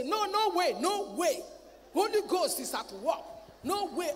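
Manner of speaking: loud, high defiant cry of no way; defiant shout. Retold or imitated. Preacher boasting he was never afraid, shouting. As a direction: shout it out against the fear. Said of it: "Don't come and say".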